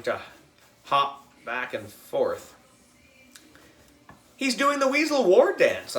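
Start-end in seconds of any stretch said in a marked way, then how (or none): 4.4-6.0 high, excited explaining. A narrator's reading. Boy telling his sister the ferret is excited. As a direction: announce it with a thrill. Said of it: "I told her".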